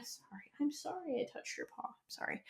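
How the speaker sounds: soft whispered apology to the dog; hushed aside, barely voiced. Real, not acted. Aside to the dog on her lap.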